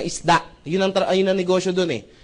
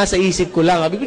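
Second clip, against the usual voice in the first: ordinary talk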